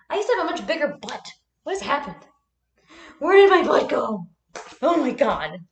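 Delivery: high-pitched comic lament, building loud; mock dismay. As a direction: wail in pretend horror at a loss. Mock lament over lost butt.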